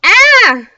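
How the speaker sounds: high, very loud shriek of fright; scream. A narrator's reading. Kids terrified by the ghost's voice, screaming. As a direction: scream in terror. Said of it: "the kids all screamed together".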